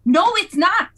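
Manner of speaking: loud, high-pitched contradiction; heated denial. Acted. Acted quarrel over the fish.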